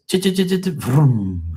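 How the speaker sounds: high rapid chugging into a vroom; engine starting imitation. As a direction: mimic a bike cranking, then revving up. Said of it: "then he went like this".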